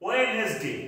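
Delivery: Wednesday with the D sounded; Wednesday said the wrong way; Wednesday incorrectly, D pronounced; wrong pronunciation. Shown as a demonstration. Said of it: "this is wrong".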